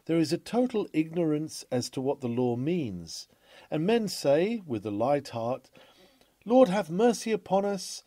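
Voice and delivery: ordinary talk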